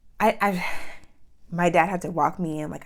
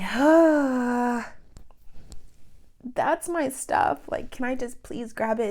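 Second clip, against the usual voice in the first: pleading; 7 semitones higher, slower than usual. Retold or imitated. Pleading with police for her stuff.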